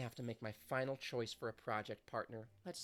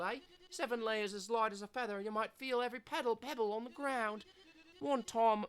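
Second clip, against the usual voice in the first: put-on louder, brash Australian accent; a loud cheeky Aussie skater hyping a mate; 6 semitones higher, 7 dB louder than usual. Voices skater Zoe.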